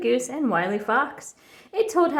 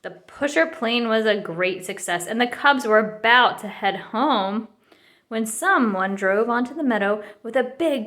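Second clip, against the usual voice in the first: usual speaking voice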